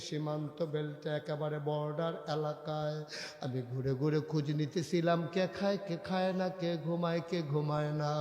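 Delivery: usual speaking voice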